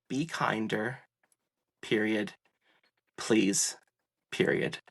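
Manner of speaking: low, soft, slow and deliberate; sincere plea. Real, not acted. Giving the host his billboard message, low and sincere. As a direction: lay each word down gently like a sign.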